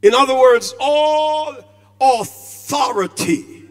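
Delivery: loud, ringing pulpit delivery, drawing out authority; preaching at full force. Acted. Preacher to his congregation on Christ's authority, booming. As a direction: proclaim it to the whole church.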